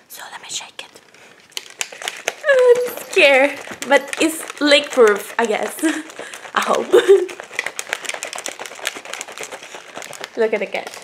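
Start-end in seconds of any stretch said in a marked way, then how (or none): 0.1-1.0 soft unvoiced whisper; hushed whisper before shaking. Real, not acted. 2.5-3.6 high, louder playful fear; mock scared while shaking the cup. Real, not acted. Nervous shaking leaky cup. 7.0-10.3 long airy laugh; breathy laugh. Real, not acted. Laughing after shaking.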